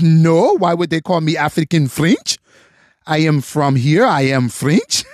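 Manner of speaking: badly faked French accent; terrible French accent. Frenchman baffled at being called African French, in a bad French accent. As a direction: put on a clumsy, indignant French accent. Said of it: "Terrible accent. I'm sorry".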